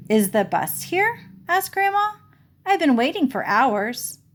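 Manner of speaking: a high, lightly voiced old woman, puzzled; confused grandma. Grandma in the teepee asks the children about the bus, confused. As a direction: play the lost grandmother gently.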